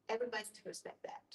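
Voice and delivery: normal talking voice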